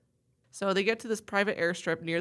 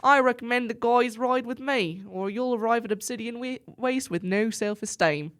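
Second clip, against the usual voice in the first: higher, put-on Aussie accent drifting English; attempted Australian accent; 4 semitones higher than usual. Offering the guys a ride, attempted Australian accent. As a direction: keep an Australian accent going through the line. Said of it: "That one wasn't".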